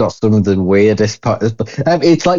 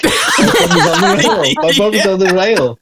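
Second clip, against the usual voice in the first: laughing sing-song; 7 semitones higher than usual. Retold or imitated. Repeating the song line, laughing.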